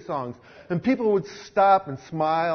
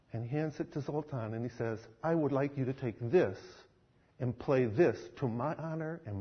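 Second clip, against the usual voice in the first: tender; 5 semitones lower, 10 dB quieter than usual. Acted. Jesus tenderly hands Zoltan a new violin, soft and low. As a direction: speak it like a blessing.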